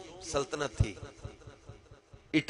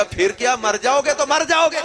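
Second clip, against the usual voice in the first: king shouts in rising anger, loud and high; angry king; 8 semitones higher, 8 dB louder than usual. Acted. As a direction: bark the threat like an enraged king. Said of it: "بادشاہ کو غصہ آ گیا". (غصے سے چیختا بادشاہ)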